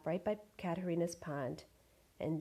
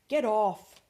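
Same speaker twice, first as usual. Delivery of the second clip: loud angry "Get off"; shout; 2 semitones higher, 11 dB louder than usual. Acted. Robber shouts at the goose, loud. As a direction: yell to shake her off. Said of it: "The man shouted".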